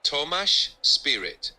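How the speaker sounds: slow, clear Tomáš with stress on the first syllable and sh ending; careful, slowed example of Tomáš Spirit; model pronunciation. Shown as a demonstration. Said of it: "You can say".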